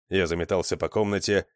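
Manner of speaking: usual speaking voice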